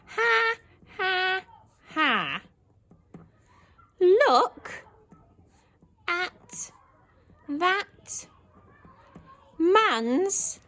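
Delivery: high laughing, spaced out for writing; laughing girl. Shown as a demonstration. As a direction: laugh word by word. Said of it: "So I'm going to go for".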